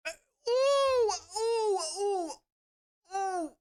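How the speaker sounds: high, wordless oohs of exasperation; frustrated groan. Real, not acted. Daughter fuming over mother's remark, exasperated high oohs. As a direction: groan as if too angry to form words. Too angry to finish her sentence.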